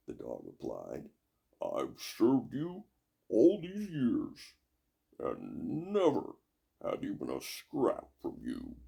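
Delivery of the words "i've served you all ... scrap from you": slow drawn-out dog voice; grumbling dog. Acted. Dog complaining to the witch. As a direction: whine the grievance slowly.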